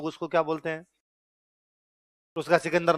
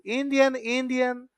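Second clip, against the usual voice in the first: put-on lightly Russian-accented, much higher; 6 semitones higher, slower than usual. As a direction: call out इंडियन like a Russian stranger. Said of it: "वो इंडियन को खूब पहचान लेते हैं".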